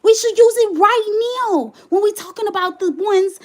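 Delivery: high and loud declaration; heated insistence. Real, not acted. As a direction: drive the point home angrily. Heated conclusion.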